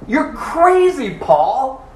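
high, loud, drawn-out CRAZY; loud outburst. Acted. Festus tells Paul he's crazy, in a loud outburst. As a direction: exclaim it high and loud, stretching the words.

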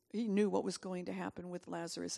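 ordinary talk, nothing marked

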